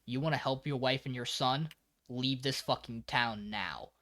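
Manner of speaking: low, soft, grave warning; quiet, deliberate ultimatum; dead serious. Retold or imitated. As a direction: lay it down quietly and firmly. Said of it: "looks him dead in the eyes".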